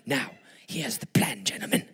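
voiceless conspiratorial whisper. Acted. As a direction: lay out the plan in a whisper. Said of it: "the Whisperer".

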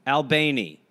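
Albany read as al-BAY-nee; Albanian-style pronunciation. Shown as a demonstration. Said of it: "and I'm Albanian".

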